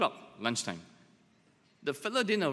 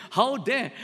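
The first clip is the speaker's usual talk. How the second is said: louder, higher show of outrage; mock indignation; 7 semitones higher, 5 dB louder than usual. Pastor playing affronted at Paul's bluntness, mock indignation. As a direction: protest as if affronted.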